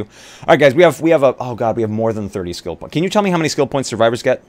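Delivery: usual speaking voice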